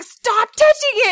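high, loud retold yell; exasperated scolding. Mom catches son at toilet, retold exasperated yell. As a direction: yell it to stop him now.

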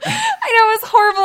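high, loud laugh-cry. Real, not acted. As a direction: squeal it through laughter. Laughing with the host.

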